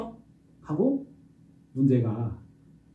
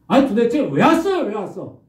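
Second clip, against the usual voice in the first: much higher, louder furious demand; angry protest; 10 semitones higher, 8 dB louder than usual. Acted. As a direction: rage at the prophet. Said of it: "막 따졌겠죠". (선지자에게 높고 크게 막 따지는 여인)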